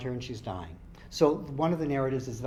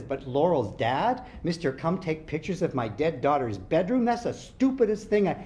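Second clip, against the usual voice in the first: louder, higher and rising in anger; angry outburst; 4 semitones higher, 6 dB louder than usual. Acted. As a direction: brother raging at the enlistment plan, rising to a yell.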